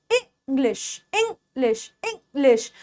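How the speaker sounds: इंग्लिश split softly as "ing... lish"; short "ing", a gap, then "lish" in इंग्लिश; short I, broken up. Shown as a demonstration. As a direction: quietly break इंग्लिश into two short syllables. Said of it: "ई की sound छोटी".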